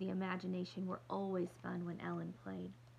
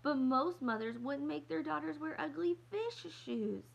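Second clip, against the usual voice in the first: much higher, louder indignant yell; bellowing; 8 semitones higher, 5 dB louder than usual. Acted. Little girl yelling about ugly fish shoes, loud and high. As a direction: keep shouting in disgust.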